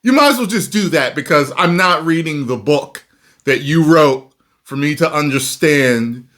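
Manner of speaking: loud and high, fed up with haters; heated rant. Real, not acted. Heated rant at hate-watchers.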